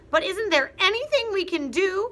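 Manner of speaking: very high, loud plea; frightened wife pleading; pleading Mrs Twit. Acted. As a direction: beg. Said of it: "cried Missus Twit".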